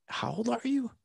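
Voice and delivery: high-pitched, incredulous question; dad marvelling at his son's wisdom, voice jumping up; amazed disbelief. Retold or imitated. Retelling to the host how he asked his son, in amazed disbelief.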